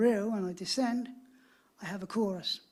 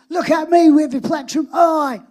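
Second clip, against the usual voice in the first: much louder and higher, hitting me; emphatic repeat; 7 semitones higher, 17 dB louder than usual. Retold or imitated. Producer repeats his lyric loudly and proudly to Sean. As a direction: proclaim the lyric with full conviction. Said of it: "What, what did you say there?".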